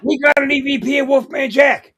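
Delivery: hooting through laughter; laughing shout. Real, not acted. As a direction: crack up while yelling the joke.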